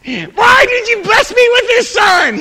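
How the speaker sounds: high, loud, slow wailing shout to heaven; mock anguished outcry. Pastor complaining to God about his son, hammed-up wailing shout. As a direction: cry out to God, hammed-up despair. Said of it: "And I got upset about it".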